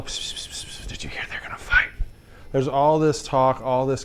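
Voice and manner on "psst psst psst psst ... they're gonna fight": hushed schoolyard whisper, unvoiced; psst-psst gossip whisper; gossipy whisper. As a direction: whisper the rumor like a kid at school.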